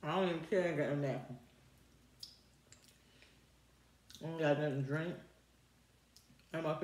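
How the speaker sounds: speaking with food in mouth; words muffled by chewing; talking while chewing. Real, not acted. Seen 0: Bit food at -7; chewing, lips closed, finger licking through most frames.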